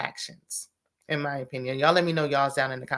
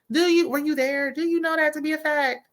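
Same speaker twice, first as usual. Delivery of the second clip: high, pointed disbelief, each question pressed; incredulous challenge; 9 semitones higher than usual. Real, not acted. Vlogger challenges Sharice's claim with incredulous high questions. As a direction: put her on the spot like you're cross-examining. Challenging a gossiping cast member.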